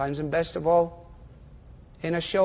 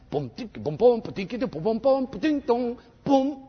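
vocal beatbox of a slap bass line; imitated bass riff; 4 semitones higher than usual. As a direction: mouth the Seinfeld bass riff. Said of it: "it always goes back to the bass guitar".

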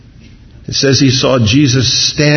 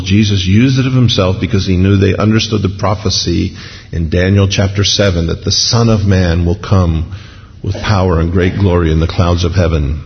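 ordinary talk, nothing marked

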